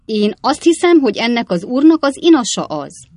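put-on high, loud old woman's voice; old lady cutting in. A narrator's reading. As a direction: break in like an indignant old lady. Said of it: "vágott közbe az öreg néni". (közbevágó öreg néni, magas hangon)

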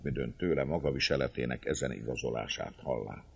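plain reading voice

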